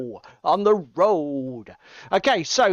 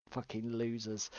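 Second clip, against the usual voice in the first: ordinary talk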